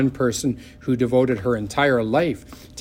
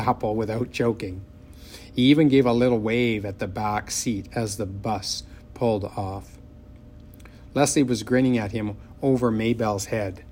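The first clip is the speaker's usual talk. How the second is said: usual speaking voice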